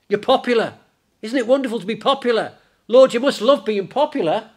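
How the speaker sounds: high, loud gushing enthusiasm; gushing excitement. Acted. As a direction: gush at him like an adoring fan. Acted disciples gushing over his popularity.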